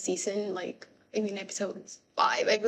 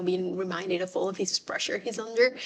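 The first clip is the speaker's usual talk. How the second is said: normal talking voice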